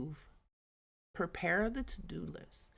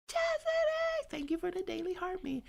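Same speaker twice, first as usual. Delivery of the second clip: excited thanks; 13 semitones higher than usual. Real, not acted. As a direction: sing out thanks to a viewer. Thanks viewer for hearts.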